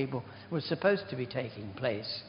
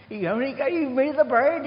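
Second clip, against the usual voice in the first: put-on higher, louder, an old man crying out; imitated loud complaint; 11 semitones higher, 8 dB louder than usual. Dying old man calling out from behind the curtains, loud complaint. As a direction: protest loudly from behind a curtain.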